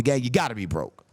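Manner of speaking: ordinary talk, nothing marked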